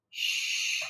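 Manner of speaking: unvoiced high shush; quick shush to quiet the dog. Real, not acted. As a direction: hush the dog.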